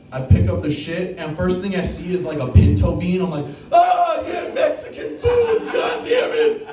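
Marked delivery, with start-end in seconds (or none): 3.7-6.7 high, loud disgusted yell; shrieking mock disgust; disgusted shout. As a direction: yelp in grossed-out disbelief. Disgusted picking up shit.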